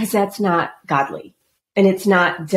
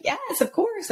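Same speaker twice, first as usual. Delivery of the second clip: high, delighted yes; high excited yes to clients; eager yes; 14 semitones higher, 6 dB quieter, slower than usual. Retold or imitated. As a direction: jump at the chance.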